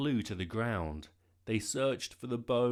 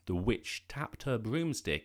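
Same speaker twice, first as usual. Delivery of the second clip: plain reading voice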